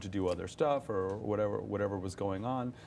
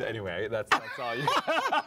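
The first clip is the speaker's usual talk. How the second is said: words breaking up into laughter; real laugh bubbling through the words; 3 semitones higher, 4 dB louder than usual. Real, not acted. Actor cracking up after his French bit, laughing.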